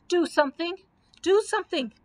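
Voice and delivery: high, urgent plea; begging. A narrator's reading. Dorothy begs friends to save the knight, urgent pleading. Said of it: "begged Dorothy".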